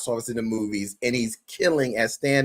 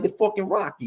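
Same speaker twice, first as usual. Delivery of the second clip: imitated Italian accent, higher pitch; 7 semitones higher than usual. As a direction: mimic a comic doing an Italian accent. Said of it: "he's doing the Italian accent".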